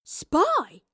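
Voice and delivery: high, loud, eager yelp; excited question. Acted. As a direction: pipe up high with sudden interest. Astrid reacts with excitement.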